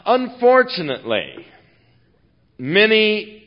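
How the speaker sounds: loud, driving pulpit preaching; preaching at full force. Acted. Preacher driving home the point to his congregation, loud and fervent.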